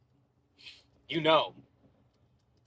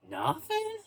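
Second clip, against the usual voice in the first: squeaky, much higher mock disbelief; baffled cook; 4 dB quieter than usual. Italian cook to customer ordering nothing, mock disbelief. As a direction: shoot way up in stunned disbelief.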